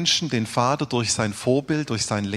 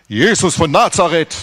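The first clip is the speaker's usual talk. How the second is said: soldiers answering; 4 semitones higher, 7 dB louder than usual. Acted.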